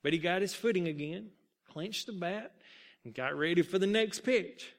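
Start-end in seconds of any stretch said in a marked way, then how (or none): none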